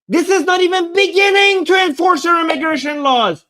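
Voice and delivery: indignant rant at full volume; outraged shout. Real, not acted. Ranting about immigration enforcement.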